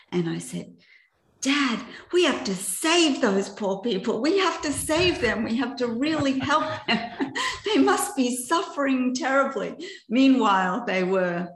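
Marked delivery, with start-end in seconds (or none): 1.4-9.7 put-on higher, urgent childlike pleading to Dad; mock-distressed appeal on someone's behalf; retold earnest plea. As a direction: plead earnestly, pitched up, breaking into a laugh. Retells own naive plea to Dad.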